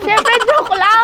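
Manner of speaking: high-pitched, loud joking outburst; loud, squeaky just-kidding cry; playful exclamation. Real, not acted. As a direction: burst out that it was all a joke, high and loud. Laughing off the ketchup joke.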